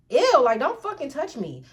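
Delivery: loud, high recoil on the first word; retold disgust, pushing someone away; mock-appalled rebuff; grossed out. Retold recoil from unwanted touch.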